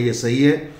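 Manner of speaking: ordinary talk, nothing marked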